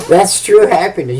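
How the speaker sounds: ordinary delivery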